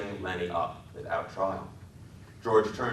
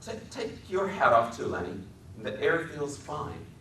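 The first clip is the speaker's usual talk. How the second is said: shakily; 7 semitones higher than usual. A narrator's reading. George gently telling Lenny to take his hat off, shaky. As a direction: hold the grief back. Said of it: "said shakily".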